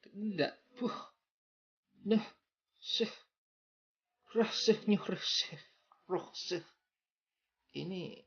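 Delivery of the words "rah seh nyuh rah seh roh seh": rah seh punched high; emphatic syllables. Shown as a demonstration. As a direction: stress each syllable hard. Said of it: "ada sebuah penekanan ya".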